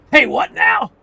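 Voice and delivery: annoyed bird voice. Acted.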